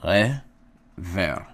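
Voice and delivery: rever said loud with rolled r; Hebrew word demo. Shown as a demonstration. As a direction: roll the r in rever firmly.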